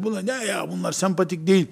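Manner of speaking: a soft, sniffy aside; disdainful mutter. Retold or imitated. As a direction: dismiss them quietly with a sneer. Disdainful friend voiced quietly.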